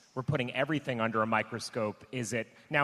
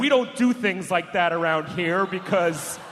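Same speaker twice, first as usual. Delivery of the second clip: mock heroic cop; 5 semitones higher, 6 dB louder than usual. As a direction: ham up a cop playing the hero. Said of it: "fan fiction, retired cop writing himself as a hero".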